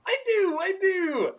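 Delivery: very high excited squeal; high-pitched eager squeal. A narrator's reading. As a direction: squeal it like a giddy kid. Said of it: "Harry squealed".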